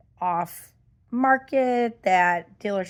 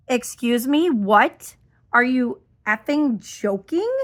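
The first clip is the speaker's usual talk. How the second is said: louder, indignant questioning; incredulous and fed up; outraged disbelief; 3 semitones higher, 4 dB louder than usual. Real, not acted. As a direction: react as if insulted by the news. Outraged reaction to the email.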